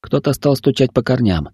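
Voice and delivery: ordinary delivery, nothing marked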